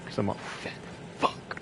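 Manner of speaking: breathy self-mocking whisper; whispered aside. Real, not acted. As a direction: drop to a whisper for the joke. Self-deprecating muttered aside.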